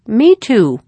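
little sister chiming in. A narrator's reading. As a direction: pop in eagerly like a small child. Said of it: "Arvella chimed in".

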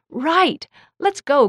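high, loud rallying call; rally the gang; excited agreement. A narrator's reading. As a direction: burst out loud and high, ready to go. Boys rally to fetch gear.

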